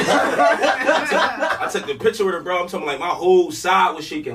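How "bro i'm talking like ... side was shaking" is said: ordinary delivery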